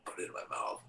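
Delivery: low, voiceless whisper; hushed, creepy aside; whispered plea. Acted. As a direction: whisper it like a secret request. Same plea repeated in whisper.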